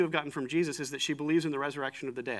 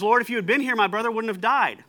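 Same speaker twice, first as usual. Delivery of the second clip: bluntly confronting, higher and louder; firm, raised-pitch reproach without fear; direct; 5 semitones higher, 5 dB louder than usual. Acted. Martha confronts Jesus over her brother's death, direct and forceful. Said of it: "she's just direct with Jesus".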